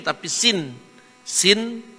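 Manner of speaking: usual speaking voice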